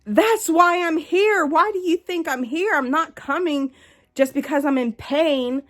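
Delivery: loud, high, furious retelling of yelling at the nurse; retold angry outburst. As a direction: relive losing it at the ER staff, voice up and loud. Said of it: "that's whenever I just lost it".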